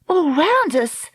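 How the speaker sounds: high, slow cry of alarm. A narrator's reading. Dakota on the hissing all about the boat, alarmed cry. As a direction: cry it out high, stretching the words. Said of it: "Dakota cried".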